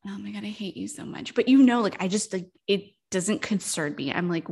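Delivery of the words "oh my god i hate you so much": much softer, under the breath; mock exasperated mutter. Real, not acted. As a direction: grumble it playfully. Teasing after friend's joke.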